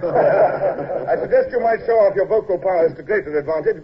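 laughing. Acted. Teasing laugh.

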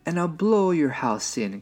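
high, mildly angry wolf threat; angry wolf cry. A narrator's reading. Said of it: "cried the angry old wolf".

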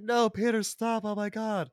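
high mock plea of no, Peter, stop; lightly voiced begging villain; imitating the Goblin begging for his life; pleading Willem Dafoe. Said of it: "we get Willem Dafoe voice, 'cause he's pleading".

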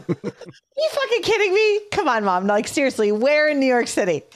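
squeaky high laughing outburst; exasperated, cracking up mid-question; laughing disbelief. Retold or imitated. Wife retelling her call to mother-in-law, laughing high disbelief.

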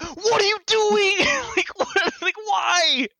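slow, high disbelief; high incredulous protest. Retold or imitated. Retold disbelief being voted out.

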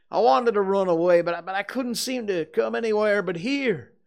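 high, loud desperate wail; moaning. A narrator's reading. Potter explaining to the crowd, anguished moaning. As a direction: wail it, stumbling over the words. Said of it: "Potter moaned".

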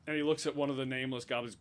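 ordinary talk

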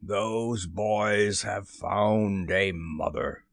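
slow, drawn-out captain's lament; heavy, slow impassioned announcement; passionate. A narrator's reading. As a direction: stretch each word in a grave captain's voice. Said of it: "Then at last he spoke passionately".

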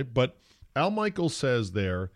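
ordinary talk

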